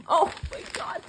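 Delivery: loud, high oh my God, stretched out; thrilled outcry over the present; excited exclamation. Real, not acted. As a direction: burst out in delight. Bursting out at gift.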